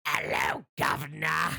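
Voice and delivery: hammed-up British monster shout; growly mock Cockney greeting; killer cab. Possessed cab baring teeth at victims, hammy British roar. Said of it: "and it goes".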